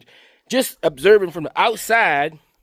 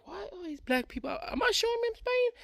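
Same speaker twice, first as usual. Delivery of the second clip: retold shock; 12 semitones higher, 12 dB quieter than usual. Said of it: "I was like".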